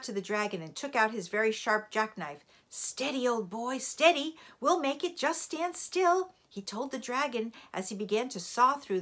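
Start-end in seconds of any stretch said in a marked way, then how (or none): 2.7-6.3 raised, firm steadying voice; steady a panicking animal while hurrying; urgent reassurance. A narrator's reading. Father steadies the frantic dragon.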